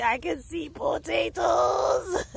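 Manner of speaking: slow, high crying-out into a long shrieking laugh; joyful shout. Real, not acted. Gardener telling viewers she sees potatoes, shouting with joy. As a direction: cry it out then dissolve into laughter. Overjoyed discovery.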